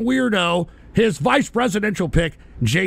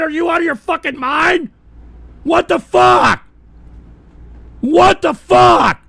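high, loud incredulous yell; real fury; furious shouting; 9 semitones higher, 8 dB louder than usual. Real, not acted. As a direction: yell in disbelief. Streamer raging over doxing.

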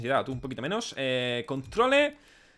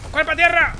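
high, loud battle yells; frantic shouting; 11 semitones higher, 5 dB louder than usual. Real, not acted. Player diving for the ground under fire, frantic high shout. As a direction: yell orders as if under fire.

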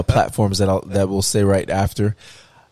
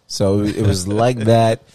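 ordinary talk

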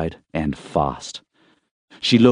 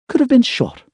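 lightly voiced Emma, higher and a bit louder; a hint of a groggy young woman just waking; young woman's voice; 4 dB louder than usual. A narrator's reading.

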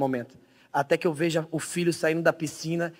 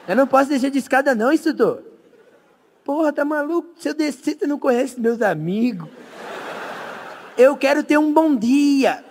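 higher, stubborn frightened refusal; scared high voice; 6 semitones higher than usual. Retold or imitated. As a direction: dig in, scared and squeaky. Tigas suplicando ao instrutor. (voz aguda e assustada teimando)

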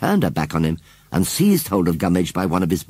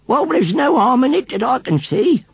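put-on old lady voice; 4 dB louder than usual. A narrator's reading.

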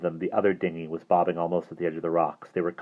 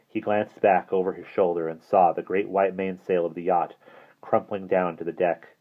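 ordinary delivery

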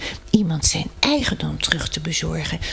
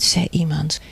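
plain reading voice

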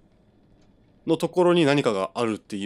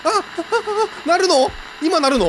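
high, louder startled questions; alarmed surprise; 7 dB louder than usual. Real, not acted. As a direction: jump at the noise. Sudden sound in game.

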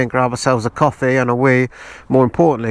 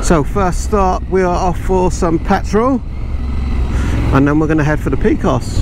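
helmet mic over engine noise; talking through a helmet while riding; inside a motorcycle helmet; 4 semitones higher, 6 dB louder than usual. Real, not acted.

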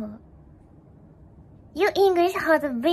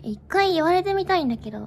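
normal talking voice

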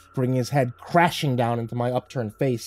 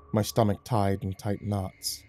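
ordinary delivery, nothing marked

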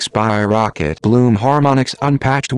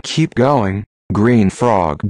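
ordinary delivery, nothing marked

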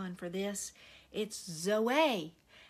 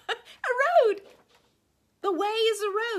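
high laughing voice, words riding on laughter; 14 semitones higher, 11 dB louder than usual. Real, not acted. Preacher laughing as she explains the word means road. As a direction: laugh through "a road" with delight. Laughing at her own point.

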